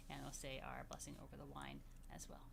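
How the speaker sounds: soft unvoiced whisper. Real, not acted. Teacher whispering an aside to the children, hushed. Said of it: "whispered aside".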